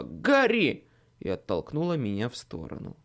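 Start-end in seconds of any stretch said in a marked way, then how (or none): 0.0-0.9 sudden cry of a name; warning shout. Acted. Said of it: "вдруг крикнула".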